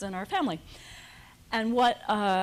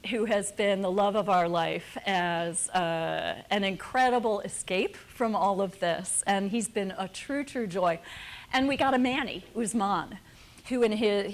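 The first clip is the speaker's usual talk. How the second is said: ordinary delivery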